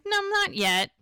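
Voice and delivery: high-pitched teasing reply; playful refusal. Retold or imitated. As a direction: lift the voice high, imitating a lover's protest. Lover declines coffee in bed.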